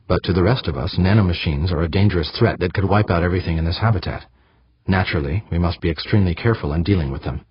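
ordinary delivery, nothing marked

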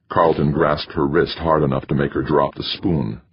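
ordinary delivery, nothing marked

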